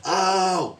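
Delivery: high drawn-out "ow"; mock pain cry. Host jokes about hurting yourself, high mock yelp. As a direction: yelp as if hurt, playfully.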